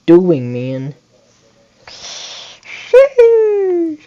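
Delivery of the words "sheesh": very high, stretched-out sheesh; squealed sheesh. Real, not acted. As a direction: let out a long, high amazed squeal. Reacts to a big play.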